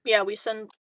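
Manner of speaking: usual speaking voice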